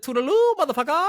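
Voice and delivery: very high, loud, gleeful send-off; hammed-up taunting goodbye; mocking retold farewell. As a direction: crow it in a high mock voice, laughing at the end. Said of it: "He said".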